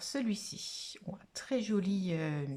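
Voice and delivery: normal talking voice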